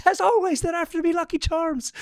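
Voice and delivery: very high-pitched cartoon Irish accent; Lucky Charms leprechaun. Shown as a demonstration. Podcaster clowning for his co-hosts as the Lucky Charms leprechaun, high mock Irish. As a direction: squeal it like a cereal-advert Irish leprechaun. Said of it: "the Lucky Charms is what you're looking for".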